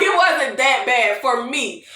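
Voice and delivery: normal talking voice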